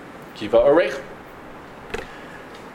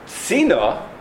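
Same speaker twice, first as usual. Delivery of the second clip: sina with a high "si" and falling "na"; stress on first syllable; 5 semitones higher than usual. Shown as a demonstration. Teacher quoting the Rav, sina stressed on the first syllable. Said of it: "the emphasis needs to be on the first syllable".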